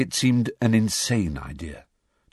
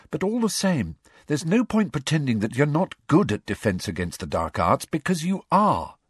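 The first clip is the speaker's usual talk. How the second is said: put-on higher, earnest girl's voice; Hermione's voice; 6 semitones higher than usual. A narrator's reading. Hermione convincing Harry he can teach, higher earnest voice. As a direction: persuade him he is good.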